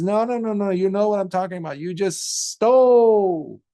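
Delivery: high and loud, rushing then slowing hard on stole; deva rebuking the monk; insistent deva. Acted. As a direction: wag a finger and drive the point home.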